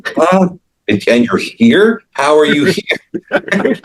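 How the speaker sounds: imitating a man too confused to follow; hammed-up disbelief, rising; mock bewildered. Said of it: "He was like".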